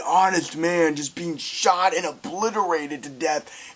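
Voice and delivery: normal talking voice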